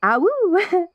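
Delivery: high, hooting ah woo; imitated wolf song. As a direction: sing the pack's one-line song. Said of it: "We make do with".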